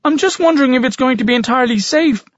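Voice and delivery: high, hurried, anxious voice; anxious boy rattling off a worry; nervous worrier. A narrator's reading. Nervous Jeekers voiced in the wings.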